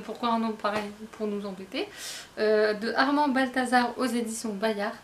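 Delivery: ordinary talk, nothing marked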